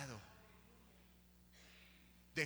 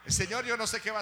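fast at first, then higher, louder shouting; preaching at full force; 5 semitones higher, 15 dB louder than usual. Acted. Preacher shaking off the mantle of mourning, shouting at full force. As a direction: rise into a prophetic cry.

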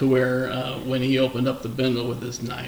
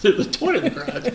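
laughing; 11 semitones higher, 3 dB louder than usual. Real, not acted. Laughing retelling.

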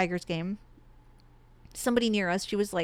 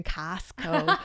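imitating a Midwestern "Costco"; mock Midwestern accent. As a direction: say Costco with a broad Midwest vowel. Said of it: "all I could hear was the Midwest accent".

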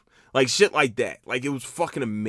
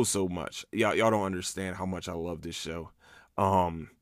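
normal talking voice

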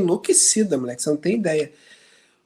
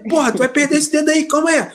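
high, teasing warning voice; hammed-up friendly warning; mock warning; 9 semitones higher than usual. Retold warning quote.

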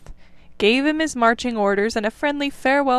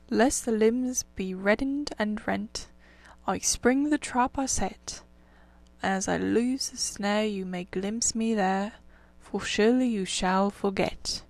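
reciting a poem; 4 dB quieter than usual. A narrator's reading. Rat recites the reed song.

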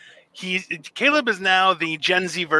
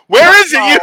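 louder, higher demand; mock demand; 10 semitones higher, 13 dB louder than usual. Friend joking how he'd demand money back, mock loud. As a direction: turn the demand back on them. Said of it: "I would actually go".

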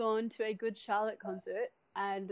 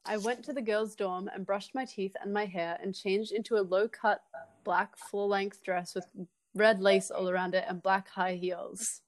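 ordinary delivery

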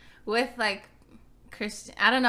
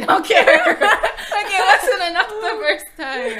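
laughing; 11 semitones higher, 12 dB louder than usual. Real, not acted. Laughing at a twice-passed basket.

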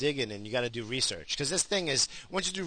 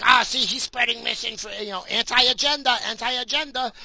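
high sneering parody of an offended woman; high mocking tattletale voice; mocking whiny complainer; 9 semitones higher, 5 dB louder than usual. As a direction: whine it back like a tattletale. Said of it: "the fat heifer's like".

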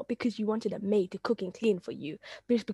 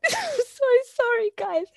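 laughing; 14 semitones higher, 4 dB louder, slower than usual. Real, not acted. Laughing apology while eating.

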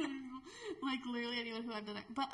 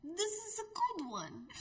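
higher-pitched attempt at a Russian accent; 7 semitones higher, much slower than usual. Shown as a demonstration. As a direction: try on a Russian accent, voice lifted. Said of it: "I think I do Russian good".

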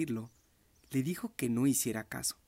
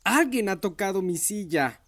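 mama bear growling; 6 semitones higher, 7 dB louder than usual. A narrator's reading. Mama bear complaining about her chair, a loud grumble. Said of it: "gruñó un poco menos fuerte".

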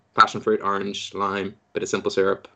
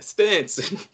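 higher mock Texan Stetson; Texan accent; 9 semitones higher than usual. Host redoes the word for the guest in a Texan accent. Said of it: "it was in a Texan accent".

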